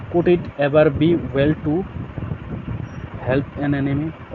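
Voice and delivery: slow, careful reading of the text; slow reading aloud. Shown as a demonstration. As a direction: read it out word by word for the class. Reads English text slowly. (धीमे से पढ़ना)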